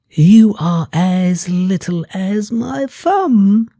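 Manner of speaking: mildly voiced woman naming the little girl; gently voiced woman. A narrator's reading. Woman speaks to tiny girl.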